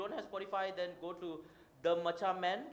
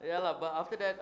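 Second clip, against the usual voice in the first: ordinary talk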